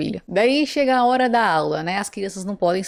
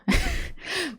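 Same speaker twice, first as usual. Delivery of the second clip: high, soft laugh; amused laugh; 11 semitones higher, 6 dB quieter than usual. Real, not acted. Narrator amused by the scene just retold, soft laugh. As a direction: let out a quiet high laugh.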